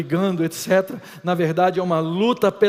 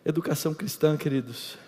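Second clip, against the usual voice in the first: normal talking voice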